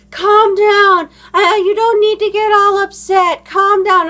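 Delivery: high, loud mock protest; mocking upset parent. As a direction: mimic an indignant parent telling someone off. Mimics the parent in retold quarrel.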